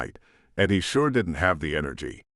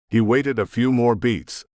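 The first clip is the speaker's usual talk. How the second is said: ordinary delivery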